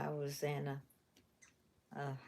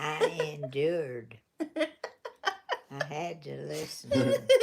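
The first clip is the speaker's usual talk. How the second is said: laughing; 9 dB louder than usual. Real, not acted. Granny joking about loud [name].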